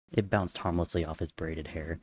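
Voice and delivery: usual speaking voice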